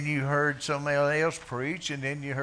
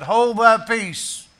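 Jesus rebuking a demon; rebuke; 7 semitones higher, 12 dB louder than usual. Acted. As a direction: command the demon out. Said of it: "Jesus rebuked him".

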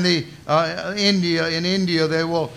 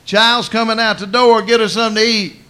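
loud call across the house, raised and higher; yelled order; 5 semitones higher, 7 dB louder than usual. Retold or imitated. Jesus calling to the mourners in the living room, loud yell. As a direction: shout it to the crowd in the next room. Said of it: "And yelled at them fools".

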